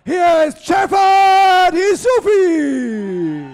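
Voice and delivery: slow, stretched, booming name call; drawn-out announcer shout. Acted. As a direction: belt out the fighter's name. Ring announcer calls boxer's name.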